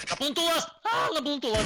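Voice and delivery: high, frightened shout; panicked yell. Real, not acted. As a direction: scream it in a panic. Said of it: "Я так испугался".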